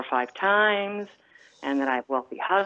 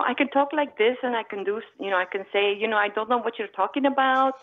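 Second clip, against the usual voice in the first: Croatian-Italian accent, pitched up; mother's foreign accent; 5 semitones higher than usual. Shown as a demonstration. Showing the host her mother's accent, foreign accent. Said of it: "I do have a foreign accent".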